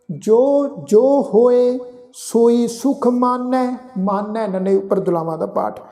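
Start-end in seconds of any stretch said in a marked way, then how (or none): 0.1-3.7 slow, drawn-out sacred recitation; measured Gurbani reading, sustained line endings; scripture recitation. Shown as a demonstration. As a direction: chant each phrase slowly with long held vowels.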